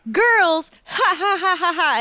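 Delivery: high cackling laugh, mocking the guy; hammed-up creepy officer laughing; a creepy officer laughs at the visitor, high and mocking. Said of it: "And officer goes".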